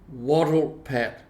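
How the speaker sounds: ordinary delivery, nothing marked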